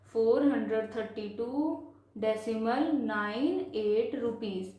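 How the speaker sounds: measured number reading, digits after "decimal" separated; slow reading demonstration. Shown as a demonstration. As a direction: stretch "nine" and "एट" one by one. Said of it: "decimal से पहले पूरा".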